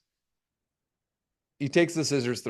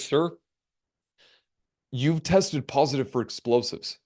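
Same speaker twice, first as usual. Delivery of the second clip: grave officer; 5 semitones lower than usual. Retold or imitated. Grave officer delivering bad news.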